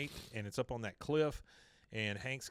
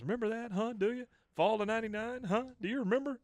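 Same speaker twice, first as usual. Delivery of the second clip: high, louder rapid-fire questioning; mock excited dog owner, each question pitched up; imitated eager coaxing; 11 semitones higher, 4 dB louder, faster than usual. Owner coaxes dog with old memories.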